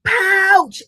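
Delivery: high, loud startled cry; frightened yell. Acted. Baby kangaroo yells fleeing the bird, loud cry. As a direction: yell it as you run from the bird. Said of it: "And he yelled".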